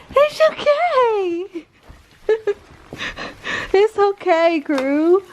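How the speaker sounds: very high, slow soothing coo; soothing pet talk. Real, not acted. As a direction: settle the dog down gently and high. Soothing the excited dog.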